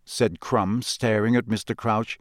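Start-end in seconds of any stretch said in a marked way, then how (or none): none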